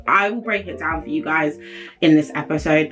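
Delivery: ordinary delivery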